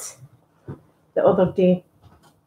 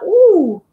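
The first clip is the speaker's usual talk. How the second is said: delighted ooh; 10 semitones higher, 6 dB louder than usual. Real, not acted. Reacting to ASMR packaging thought.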